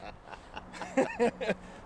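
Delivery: high breathy giggle; nervous laugh. Real, not acted. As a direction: laugh off the nerves. Nervous laugh after joking.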